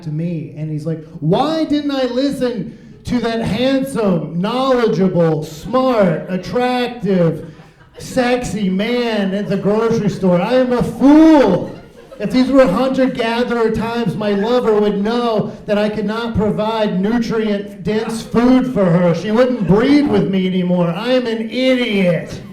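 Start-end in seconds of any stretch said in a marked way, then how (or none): 1.2-11.8 high, slow, hammed-up lament of a fool; regretful customer. Customer mocking himself for not listening. 12.2-22.4 hammed-up caveman regret, higher; lamenting customer. Customer lamenting he can't provide for his lover.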